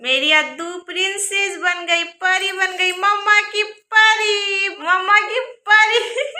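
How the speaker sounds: 3 coo over her like she's a little princess; 4 mother cooing over her daughter's new dress, high and delighted. Real, not acted.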